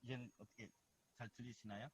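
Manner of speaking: barely audible phone line; faint connection. Real, not acted. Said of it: "아니요, 아직도 너무 적은데". (멀리서 작게 들리는 통화 소리)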